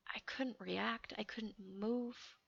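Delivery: slow, near-whispered confession; very soft and drawn out; hushed. Real, not acted. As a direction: drop to a slow, breath-thin hush. Recalls being frozen in terror.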